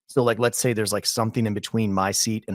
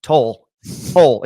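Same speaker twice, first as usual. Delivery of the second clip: louder, insistent toll calls while hitting him; wife waking husband at toll booth, loud insistent calls; urgent loud calls; 3 semitones higher, 8 dB louder, much slower than usual. Retold or imitated. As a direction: wake the driver with loud repeated calls. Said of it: "And she goes".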